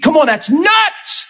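scoffing outburst. Real, not acted. Preacher scoffing at absurd idea.

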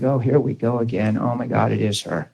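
low, quiet dread at the ringing phone; weary groan. Retold or imitated. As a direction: sigh it out, fed up with the caller. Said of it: "it's like".

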